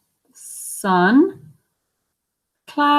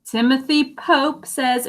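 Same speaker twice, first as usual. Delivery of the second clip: ordinary delivery, nothing marked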